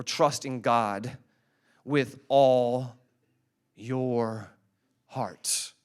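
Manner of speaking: slow, with a pause before each word; slow, paced recitation. Shown as a demonstration. Preacher leads the verse word by word, slowly. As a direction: lay out each word for the congregation to repeat. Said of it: "Can you all say that with me".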